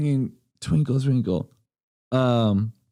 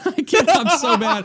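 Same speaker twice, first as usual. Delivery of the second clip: laughing; 12 semitones higher, 3 dB louder than usual. Real, not acted. Laughing at own failed attempt.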